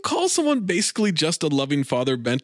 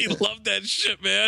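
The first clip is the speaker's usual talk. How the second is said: amused disbelief; 9 semitones higher, slower than usual. Real, not acted. Echoing the amazed reaction.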